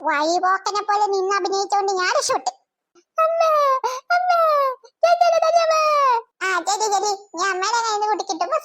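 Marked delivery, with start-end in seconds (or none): 3.2-5.7 put-on high, whining child's wail; wailing for mother. Younger child complaining to mother about sister, wailing. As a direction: wail out for mum to hear.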